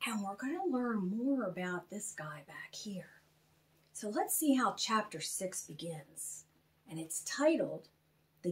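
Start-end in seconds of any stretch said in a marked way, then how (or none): 0.1-3.2 soft unvoiced whisper. Host teasing children about the guy back here, whispered. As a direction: lean in and whisper the tease.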